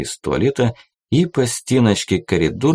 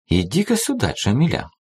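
higher, coaxing purr; 7 semitones higher than usual. A narrator's reading. Jennifer lures the soloist into the tent folds, purring. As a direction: lure her closer with a purr. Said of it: "промурлыкала Дженнифер".

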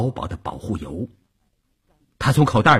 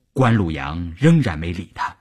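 ordinary delivery

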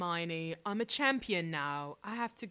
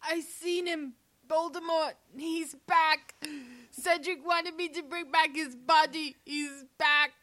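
frantic, higher and louder cries; panicked shout; 6 semitones higher, 5 dB louder than usual. Acted. Panicked return, announcing Voldemort.

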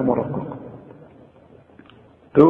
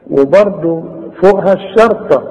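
normal talking voice

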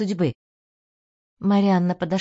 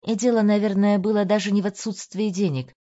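ordinary delivery

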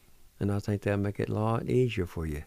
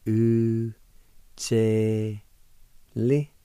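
syllable-by-syllable pronunciation; 4 dB louder, much slower than usual. Shown as a demonstration. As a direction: sound out "U, che, li" with clear pauses. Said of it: "is pronounced like this".

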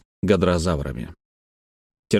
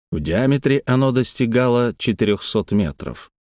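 ordinary delivery, nothing marked

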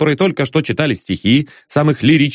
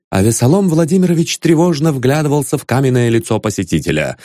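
ordinary delivery

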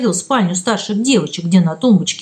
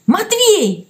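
high call of his name; cry out; 10 semitones higher than usual. A narrator's reading. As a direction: shout his name in alarm. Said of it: "крикнула она". (громкий оклик)

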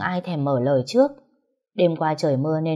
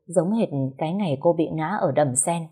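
ordinary delivery, nothing marked

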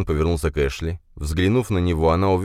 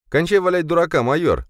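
sharp raised shout at the major; 13 semitones higher, 4 dB louder than usual. Acted. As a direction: snap it out loud and high. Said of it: "прикрикнул на него Бен".

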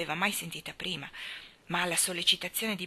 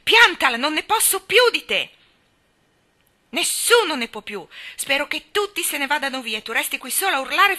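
high, loud furious tirade; angry girl shouting at a tantrum; 6 semitones higher, 7 dB louder than usual. A narrator's reading. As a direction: scream him down. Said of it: "urlò forte".

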